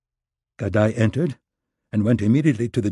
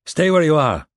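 sharp, loud order at gunpoint; a gunman barking at the startled couple; commanding order; 8 semitones higher, 5 dB louder than usual. Acted. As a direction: freeze them where they stand. Gunman bursts into office.

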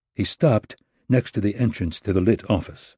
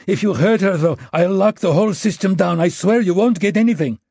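high, loud, frantic threat; desperate threat; 11 semitones higher, 5 dB louder than usual. Acted. Maxim threatens despite fear.

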